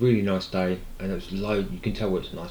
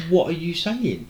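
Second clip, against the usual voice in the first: baffled; 8 semitones higher than usual. Retold or imitated. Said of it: "he just kept saying".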